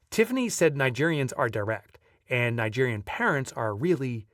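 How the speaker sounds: usual speaking voice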